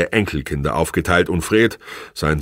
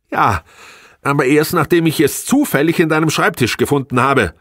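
high, loud indignant outburst; 10 semitones higher, 5 dB louder than usual. A narrator's reading. Wife who found the report in his desk, indignant. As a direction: exclaim it in outrage, pitched high. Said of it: "rief sie empört aus".

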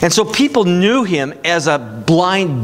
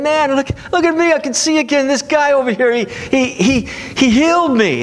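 much higher, thrilled voice of the healed blind man; excited healed man; 9 semitones higher than usual. Retold or imitated. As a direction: show his joy at seeing again. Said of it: "this guy's excited".

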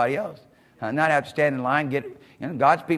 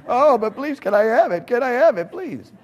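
much higher, loud mock begging; begging loved one; 10 semitones higher, 8 dB louder than usual. Loved one keeps asking for what was just given, exaggerated pleading. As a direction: beg over and over, hammed up and high-pitched. Child begs again for given gift.

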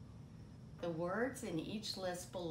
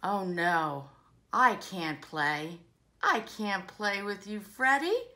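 Minnie refusing, exasperated; 11 semitones higher, 15 dB louder than usual. Acted. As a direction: build to an insistent, drawn-up refusal.

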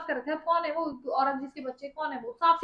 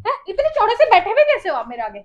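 loud, raised-pitch confrontation; indignant, higher and louder; angry challenge; 5 semitones higher, 8 dB louder than usual. Real, not acted. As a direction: take him on loudly. Wife challenges husband in quarrel.

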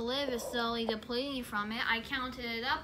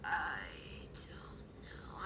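whisper; 12 semitones lower, 11 dB quieter, much slower than usual. Real, not acted. Trails into a whisper.

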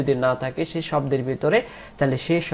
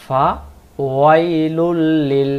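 ওয়াইলুল drawn out slowly and louder; slow recitation; 6 dB louder, much slower than usual. Shown as a demonstration. Teacher demonstrating verse to students, slow and louder. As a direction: chant ফা ওয়াইলুল লিল with long held vowels. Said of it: "এরপরে আসেন".

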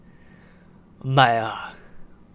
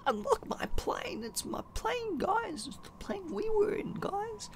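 tiny, very high squeak, much softer; squeaky high voice; 15 dB quieter than usual. Acted. Gamer spotting his crashed plane, in a tiny high squeak. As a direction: squeak it out like a cartoon mouse.

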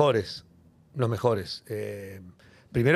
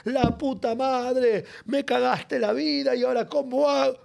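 high, slow exasperated complaint; mock furious cabbie; 9 semitones higher, slower than usual. Taxi driver hit from behind, high exasperated lament. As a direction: act the cabbie who got hit, wailing about his ruined day.

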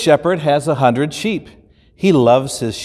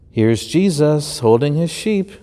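usual speaking voice

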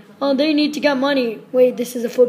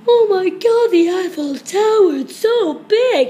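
amazed gasp; 7 semitones higher, 5 dB louder than usual. Pretend. Player marvels at Eiffel Tower.

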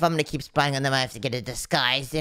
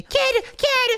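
high, squeaky call; urgent calling. Acted. Spy calls to kid inside, urgent high squeal. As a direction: call out urgently to get attention.

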